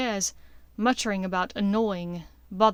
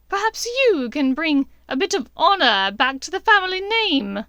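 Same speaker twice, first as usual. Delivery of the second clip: condescending witch; 7 semitones higher, 9 dB louder than usual. A narrator's reading. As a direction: belittle him sweetly. Bellatrix belittling his mother.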